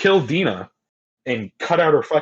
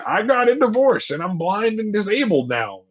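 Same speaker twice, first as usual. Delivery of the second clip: high, bright mock Tommy; mock chirpy Tommy; 10 semitones higher than usual. Friend mocks Tommy announcing his troubles, high and chirpy. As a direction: play Tommy breezily listing woes. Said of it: "he's like".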